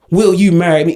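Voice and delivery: low mocking voice for a proposer; mock proposal. Acts out the mocked proposal.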